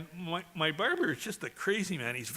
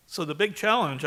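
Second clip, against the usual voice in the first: ordinary talk, nothing marked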